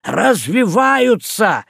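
slow loud cry; drawn out shout. A narrator's reading. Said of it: "закричал кацман".

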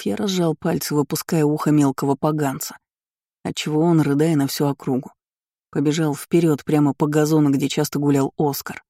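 usual speaking voice

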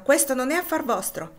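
high, loud, defiant retort; shouted retort. A narrator's reading. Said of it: "gridò Topsy sfuggendole di mano".

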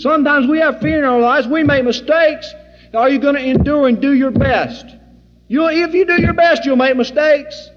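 loud, high preacher's exhortation; preaching at full force. Acted. Preacher urging the flock to endure, full force. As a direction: drive it home to the congregation.

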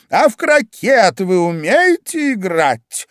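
high, loud, slow demand; barked question. A narrator's reading. Queen quizzes Alice about croquet, barking. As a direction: bellow the question imperiously. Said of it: "гаркнула королева".

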